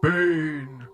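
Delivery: theatrical villain introducing a show; Bane. Acted. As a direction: drag each phrase out with menacing ceremony. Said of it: "I'm Bane, goddammit".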